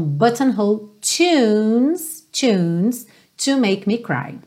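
phrase spoken slowly, words linked; slow demo. Shown as a demonstration. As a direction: say it slowly for practice. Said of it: "vamos praticar". (devagar para praticar)

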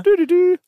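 high loud secret agent jingle; spy theme hum. Pretend. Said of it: "wie so ein Geheimagent".

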